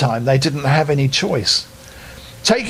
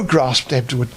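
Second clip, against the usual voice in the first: usual speaking voice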